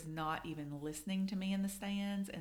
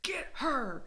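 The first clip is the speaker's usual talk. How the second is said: a whispered then shouted get her, pitched way up; mock-yell at your daughter from the stands; retold sideline yell; 7 semitones higher, 5 dB louder, much slower than usual. Mom urging her daughter on at soccer, intense yell. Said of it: "I would yell at her".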